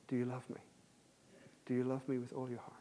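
hushed, tender question from God; God's gentle voice. Acted. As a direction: ask it softly, as God asking. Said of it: "I want to hear God's voice to you".

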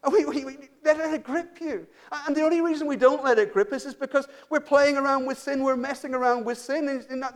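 high-pitched, urgent preaching; impassioned preaching. Real, not acted.

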